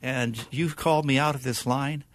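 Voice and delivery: loud, high, emphatic telling; excited recognition. Retold or imitated. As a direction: burst out with grateful recognition. Young minister recounting gratefully.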